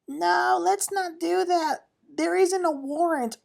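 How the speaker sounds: high-pitched, drawn-out interjection; butting in. Retold or imitated. Erica butts into the argument, high-pitched interjection. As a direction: cut in with a high, stretched-out objection.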